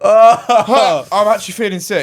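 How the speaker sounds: high, loud laughing protest; disgusted laughter. Real, not acted. Host reacts to eaten nail clippings, disgusted laughter. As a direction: laugh while feeling queasy.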